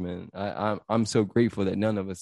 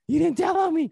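much higher, louder squeal of relief; overjoyed squeal; 4 dB louder than usual. Retold or imitated. Boy squealing that his friend didn't snitch, overjoyed. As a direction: burst out with giddy relief. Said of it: "I was like".

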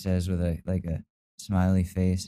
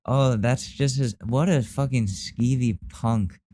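outraged; 3 dB louder than usual. Real, not acted. Outraged at predator.

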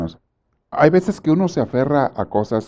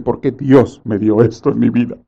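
mock complaint; 3 dB louder than usual. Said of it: "hay descarados que dicen".

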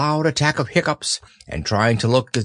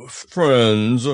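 pompous Eeyore. Acted. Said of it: "coughed in an impressive way".

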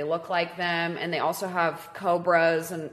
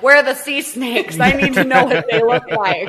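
louder, higher panicked outburst, played for laughs; frantic demand; 4 semitones higher, 10 dB louder than usual. Nervous snorkeler demanding to see the sea snakes, frantic yell. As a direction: yell it like a comically alarmed tourist.

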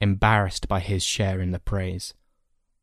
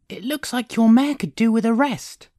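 put-on high, loud boyish voice; persuasive boy; 5 dB louder than usual. Boy gesturing to the old man's horse, persuasive. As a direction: coax him in a high voice.

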